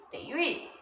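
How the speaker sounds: ordinary delivery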